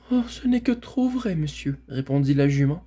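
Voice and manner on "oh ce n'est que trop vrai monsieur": high, mournful little voice; melancholy mare. A narrator's reading. Mare answering Bré about her slavery, melancholy. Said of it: "avec un petit hennissement mélancolique".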